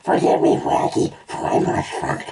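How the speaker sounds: stage-whisper it as a speech-impaired cartoon dog; whispered, garbled dog accent. A narrator's reading.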